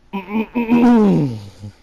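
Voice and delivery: helpless cringing laugh; loud laugh. Real, not acted. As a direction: burst of high embarrassed laughter. Reading cringe fanfic aloud.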